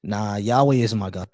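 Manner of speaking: usual speaking voice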